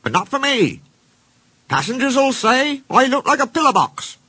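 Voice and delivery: high, indignant little-engine whine; fuming. Acted. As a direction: carry the complaint upward, cross and put out. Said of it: "fumed Mike".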